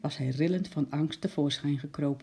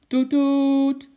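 loud, high honk, held long; toeter nadoen; 10 semitones higher, 8 dB louder than usual. A narrator's reading. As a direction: imitate a car horn. Toeter onderbreekt het gesprek. (luid, hoog getoeter)

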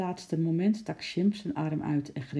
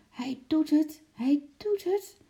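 very high, thrilled squeal; excited cry; 12 semitones higher than usual. A narrator's reading. Henkie watching the monkey climb, excited. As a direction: call it out high, stretching the repeat. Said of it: "riep Henkie opgewonden".

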